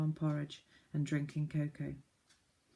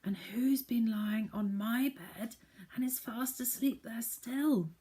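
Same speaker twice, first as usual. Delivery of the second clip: higher, slow cub voice; a small cub's wondering voice; baby bear; 6 semitones higher, slower than usual. Acted. Baby bear finding someone in his cot, high and slow. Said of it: "said the baby bear".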